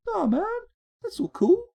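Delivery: high, loud goofy voice; mock dumb voice. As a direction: play an oblivious fool. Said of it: "sitting here going".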